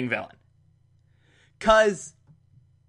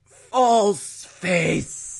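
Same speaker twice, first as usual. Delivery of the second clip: dramatic drawn-out fading cry; mock-dramatic words while passing out; imitated knockout gasp; 3 semitones higher than usual. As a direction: act out a dazed last utterance. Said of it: "that's how he said it".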